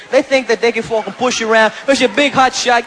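ordinary talk, nothing marked

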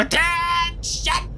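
drawn out parade command; parade ground voice. Acted. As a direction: bark it like a drill sergeant. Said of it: "puts on his best parade ground voice and goes".

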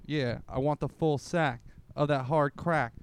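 ordinary talk, nothing marked

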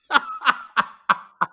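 high, loud burst of laughter; big high-pitched laugh in bursts; real laugh. Real, not acted. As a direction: crack up loudly. Laughs at being told answer.

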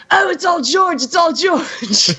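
high, eager mock voice of a humble star, laughing; imitating Ray Park. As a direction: play Ray brushing off the praise, high and giddy.

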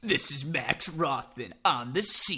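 slow, lightly voiced on-scene reporter; sports reporter voice. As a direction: mimic a TV field reporter, slowly. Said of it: "is that your sports reporter voice".

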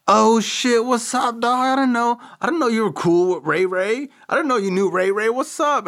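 very high-pitched imitation of a thrilled pal; excited surprise. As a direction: squeal a surprised hello, mimicking a friend. Retold friend's surprised greeting.

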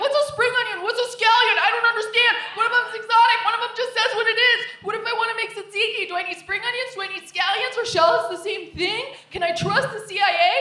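high, loud baffled rambling; a clueless man lost among the onions; confused guy. Retold or imitated. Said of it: "he was like".

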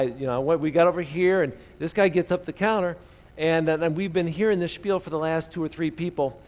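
usual speaking voice

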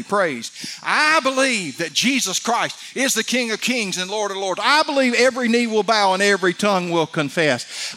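building, emphatic preacher's declaration; impassioned preaching. Real, not acted. Preacher declaring his creed to the congregation, building in fervour. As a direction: declare each belief with rising force.

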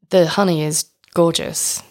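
normal talking voice

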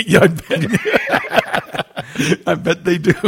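laughing high through the words. Real, not acted. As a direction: chuckle out a teasing reply. Teasing laugh at co-host.

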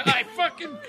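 high-pitched mock outrage; retold anger. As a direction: imitate an angry man sputtering. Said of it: "he's all mad".

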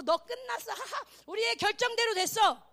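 lightly acted villain's gloat with "haha"; mock gloating. Acts the world gloating over Daniel. (높은 소리로 승리한 척 비웃기)

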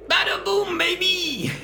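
very high, loud holler; screamed catchphrase. Acted. Scrad flying at Iris to land the hit, screaming. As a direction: belt it out as a battle cry. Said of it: "I'm screaming".

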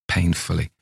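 ordinary delivery, nothing marked

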